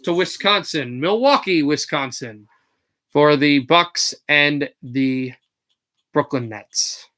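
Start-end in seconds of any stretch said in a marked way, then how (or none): 1.6-7.1 joking low rumble of a voice; goofy deep voice. Shown as a demonstration. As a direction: drop into a silly deep voice. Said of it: "Oh, sorry for that".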